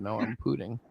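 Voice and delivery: "pooting" with a long oo for pouting. Retold or imitated. Retelling the customer's reply, pouting said as "pooting". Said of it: "Are you pouting?".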